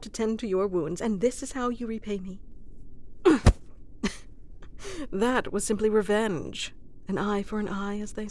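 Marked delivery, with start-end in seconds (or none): 2.4-5.1 airy higher giggle; a pleased breathy laugh; gleeful laugh. Acted. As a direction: laugh at a petty win. Enjoying the revenge.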